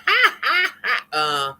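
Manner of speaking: high mock "ha ha ha"; imitated laugh. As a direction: mimic her laugh. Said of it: "She done".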